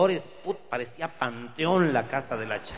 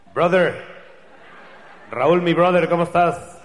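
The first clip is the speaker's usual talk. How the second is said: louder, higher hearty hail; loud buddy greeting; 6 semitones higher, 12 dB louder than usual. Acted. Buddy greeting Raúl loudly in the plaza. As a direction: call out to your brother across the plaza.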